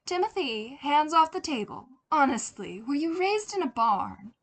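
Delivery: a light maternal scolding. Acted. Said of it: "Janet scolded him lightly".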